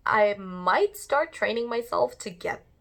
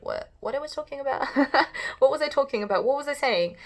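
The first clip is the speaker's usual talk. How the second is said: higher-pitched, puzzled self-questioning; mock confusion; 6 semitones higher, 3 dB louder, faster than usual. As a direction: act out losing your train of thought. Acting out losing her thread.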